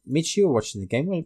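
ordinary talk, nothing marked

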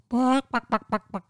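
high yappy barks; mock dog barks; barking dog. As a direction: bark like a playful dog.

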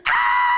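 piercing high scream of pain; hammed-up shriek. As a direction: shriek as the sting hits. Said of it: "and she goes".

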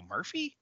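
"Murphy" as a soft, rising question. Acted. Woman spotting her original partner, "Murphy" softly questioning. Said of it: "like, that, that question".

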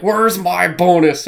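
mock demanding character. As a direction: imitate a guy loudly demanding his due. Imitating a movie character's demand.

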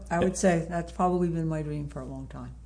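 usual speaking voice

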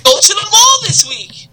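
very high-pitched announcer parody; comic character host. Acted. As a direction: announce it in a squeaky-high put-on voice. Character hosts his joke segment.

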